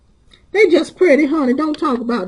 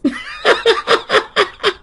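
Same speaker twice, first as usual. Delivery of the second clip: very high, wheezy cackle; long, high burst of laughter. Real, not acted. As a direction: crack up helplessly. Laughs at own story.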